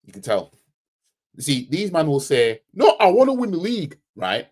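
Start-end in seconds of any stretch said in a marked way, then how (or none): 2.7-4.0 high, loud mocking protest. Imitating fans defending their ambition, mock protest. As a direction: imitate an insistent fan, pitched up. Mimicking what these fans say.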